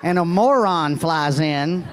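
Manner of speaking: usual speaking voice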